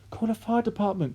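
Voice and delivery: high, urgent cry; mother calling for help in panic; panicked cry. A narrator's reading. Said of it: "cried Missus Cranky".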